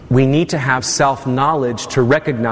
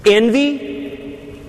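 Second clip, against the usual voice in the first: ordinary delivery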